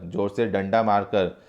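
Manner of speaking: usual speaking voice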